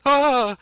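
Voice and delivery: loud, high cry of fright; startled scream. Acted. Mayor waking from a spell and falling back, startled scream. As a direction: yelp as you come to in terror. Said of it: "He goes".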